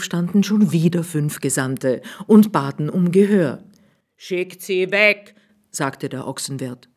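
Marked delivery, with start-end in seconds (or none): 4.2-5.4 slow, raised innkeeper turning them away; lightly voiced innkeeper, brushing them off; slightly higher, slow innkeeper; innkeeper's voice.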